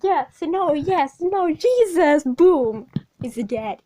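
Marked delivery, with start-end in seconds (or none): 0.0-2.2 flustered J-Day. Retold or imitated. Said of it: "He's like".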